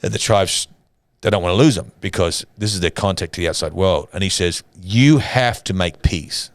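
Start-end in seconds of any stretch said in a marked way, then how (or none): 4.8-6.5 slow, emphatic command, each word weighed; firm ultimatum. Retold or imitated. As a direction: lay down the condition slowly and firmly. Missionary's ultimatum to warring tribes.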